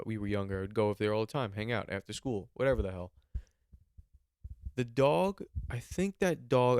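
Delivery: usual speaking voice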